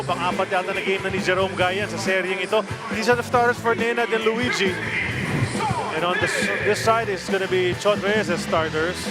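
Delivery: ordinary talk, nothing marked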